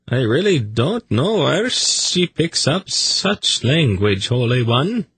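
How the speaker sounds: high-pitched embarrassed protest; apologetic. A narrator's reading. Zadi excusing his snake's language to Agachak, apologetic. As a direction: declare it like an embarrassed pet owner. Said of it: "He looked apologetically at Agachak".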